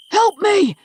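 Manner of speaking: high, loud pleading shout; desperate plea. Acted. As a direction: beg for help at the top of your lungs. Father begging from cellar.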